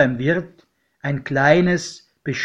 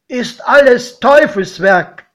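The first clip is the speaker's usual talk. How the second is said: higher, louder godfather outburst; loud denouncing; 7 semitones higher, 8 dB louder than usual. A narrator's reading. Sceptical godfather dismissing the new railway, loud and high. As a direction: denounce it loudly, pitch raised.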